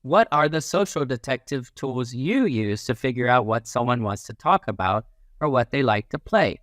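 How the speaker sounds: ordinary delivery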